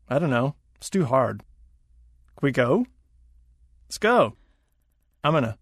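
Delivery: Jeet said as one clipped word for did you eat; colloquial reductions. Shown as a demonstration. Second voice models reduced phrases for learners, casual slurred speech. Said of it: "Colloquial Reductions and Liaisons".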